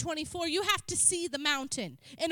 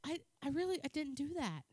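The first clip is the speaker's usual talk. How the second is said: softer, faltering denial; mock sheepish denial; 9 dB quieter than usual. Husband denying to his wife, softer and faltering. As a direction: play the husband backing down quietly. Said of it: "and he said".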